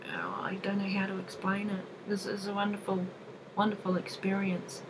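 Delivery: breathy whisper, at a loss for words; overwhelmed whisper. Real, not acted. To the viewers about her attunement, a real whisper. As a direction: whisper it, too moved to explain.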